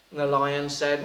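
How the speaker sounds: ordinary delivery, nothing marked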